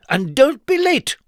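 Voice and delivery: high, loud bossy command down the phone; bossy shout. A narrator's reading. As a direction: bark the order high and loud. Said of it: "she shouted down the phone".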